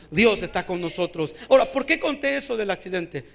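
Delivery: normal talking voice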